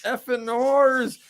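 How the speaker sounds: high, slow cursing pirate voice; pirate swearing. Retold or imitated. Skull pirate cursing the mermaids as the ship passes, high and slow. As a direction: curse them out in a high, drawn-out pirate voice.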